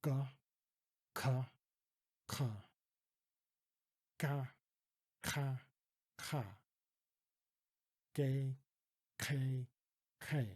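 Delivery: "Ga, ka, ka" with a hard, forced throat sound; forceful isolated first sound. Shown as a demonstration. Teacher demonstrates the forceful Dutch G. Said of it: "isolate that first sound and give it more force".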